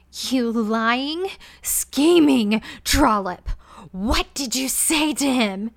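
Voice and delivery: raging queen shouting at her maid; roaring fury. Acted. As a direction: hurl it at her like a slap. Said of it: "she roared".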